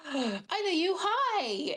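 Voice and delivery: high, bright, eager greeting; excited recognition. Acted. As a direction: squeal hello like a delighted child. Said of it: "she'll be like".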